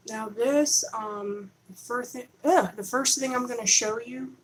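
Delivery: ordinary talk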